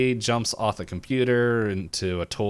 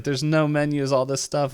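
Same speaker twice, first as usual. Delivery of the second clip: high-pitched flustered complaint; mock freaking out; 6 semitones higher, 4 dB louder than usual. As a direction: play at mild panic. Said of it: "like, freaking out".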